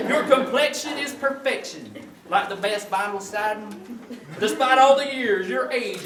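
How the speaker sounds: corny poem read with laughs; comic love poem. Acted. Comedian reading his wife a corny love poem, sing-song recital.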